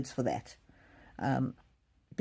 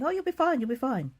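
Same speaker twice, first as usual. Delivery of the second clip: much higher, breezy dismissive comfort, then falling off; breezy reassurance; 8 semitones higher than usual. Retold or imitated. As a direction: wave away her worry lightly. Brushing off mother-in-law's worries.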